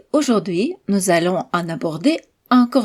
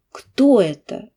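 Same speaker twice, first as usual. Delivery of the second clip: Кто raised, then falling; stress on Кто. Shown as a demonstration. Teacher to students, stress on Кто. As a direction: voice up on Кто, down right after. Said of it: "la voix monte sur ce mot et redescend".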